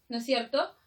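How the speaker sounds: ordinary delivery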